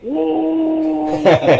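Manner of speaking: long high loud "whoa"; high, loud, held hoot of amazement; imitated whoa. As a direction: imitate a stunned onlooker's drawn-out whoop. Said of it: "Big Show went".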